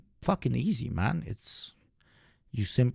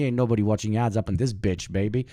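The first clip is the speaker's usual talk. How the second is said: usual speaking voice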